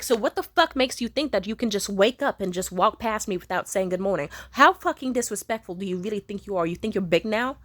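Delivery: loud, furious confrontation in the bathroom doorway; loud raging mother demanding a greeting; angry yelling mom. Retold or imitated. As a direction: bark it at her like a cornering parent. Abusive mom yelling at daughter.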